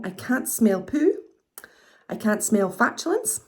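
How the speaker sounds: ordinary talk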